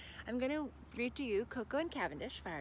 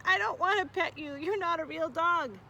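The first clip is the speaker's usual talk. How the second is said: put-on higher, louder child's protest; 6 semitones higher, 6 dB louder than usual. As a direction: protest like an upset boy. Boy refuses angrily.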